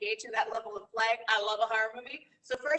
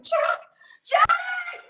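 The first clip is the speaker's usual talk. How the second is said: high, loud desperate call; 7 dB louder than usual. Shown as a demonstration. Rose calling to Jack in the water, loud desperate call. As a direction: yell for him across the water. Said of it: "call out to him".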